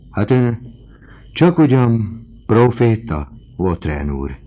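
high, loud amazement; astonished. A narrator's reading. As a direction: marvel aloud, voice lifted. Landlady astonished at Vautrin's foresight.

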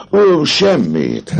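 the captain feigning innocence, high and slow; breezy dismissal. A narrator's reading. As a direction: brush it off with a drawn-out innocent tone. Phoebus deflecting.

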